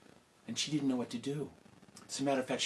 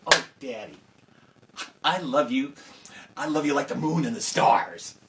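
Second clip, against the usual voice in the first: gushing daughter; 3 semitones higher, 9 dB louder than usual. Acted. Oldest daughter flattering father.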